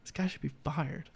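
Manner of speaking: hushed aside to himself; inner thought. Retold or imitated. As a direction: voice a private thought very softly. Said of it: "in my head, I'm like".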